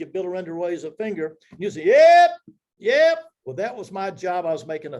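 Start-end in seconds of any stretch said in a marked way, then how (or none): 1.9-3.2 yep shouted high and very loud; a spotter yelling out a bid, sharp and loud; hollered yep. Retold or imitated. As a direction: holler it across the auction floor to the auctioneer.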